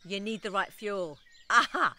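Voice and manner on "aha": playful gotcha. Real, not acted. Teasing listeners about fuel.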